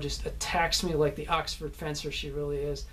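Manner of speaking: ordinary talk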